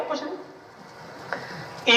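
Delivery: slow, whispered कुछ नहीं; hushed answer. Acted. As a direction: drop to a breathy whisper for nothing. Preacher answers his own question.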